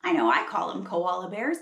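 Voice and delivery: plain reading voice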